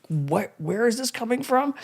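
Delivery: retold bafflement. Baffled at his friend's Russian television advice, incredulous. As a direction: ask it in high-pitched disbelief.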